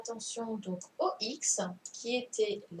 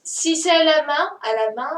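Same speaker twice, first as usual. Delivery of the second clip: louder and higher, leaning on "main"; insistent; 7 semitones higher, 11 dB louder than usual. Real, not acted. Teacher corrects a pupil's accent, loud and insistent. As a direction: raise the voice to make the point.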